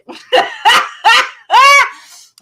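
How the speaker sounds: big high burst of laughter; loud high laugh. Real, not acted. Laughing loudly at her own story of the shock. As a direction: lets out a loud cackling laugh.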